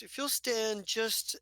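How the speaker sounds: coaxing him into place, unhurried; gentle doctor. Acted. Telvono positions PV gently.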